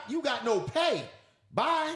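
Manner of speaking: high, emphatic mock firing; dismissive boss. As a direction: play a boss curtly sending someone off. Acted boss suspending an employee.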